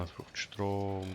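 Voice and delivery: ordinary delivery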